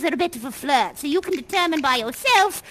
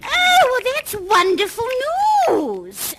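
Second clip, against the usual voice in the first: much higher and louder, thrilled at the news; delighted; 10 semitones higher, 6 dB louder, slower than usual. Real, not acted. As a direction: gush with joy at the wedding. Reacts to wedding news.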